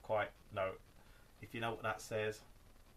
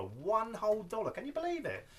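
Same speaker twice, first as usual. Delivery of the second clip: high, louder mock disbelief; mock amazement; 11 semitones higher, 4 dB louder than usual. Collector boasting of a one-dollar find, mock amazed. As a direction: marvel theatrically at a tiny bargain. Marvels at a bargain record.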